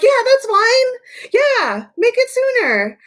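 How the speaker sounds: very high, slow sing-song agreement; hammed-up cheerful falsetto; mock chipper clients. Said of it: "And everyone's like".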